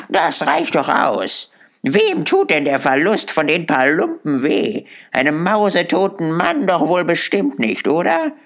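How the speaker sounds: put-on high-pitched defiant old charwoman; lightly voiced, brazen and challenging; a hint of a coarse, scornful old woman; hostile charwoman. A narrator's reading.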